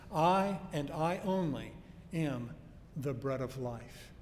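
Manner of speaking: slow, stressing "I and I only" word by word; a preacher pressing home "I and I only"; emphatic. Shown as a demonstration. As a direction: lean hard on "I" and "only", slowly. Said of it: "this is an emphatic".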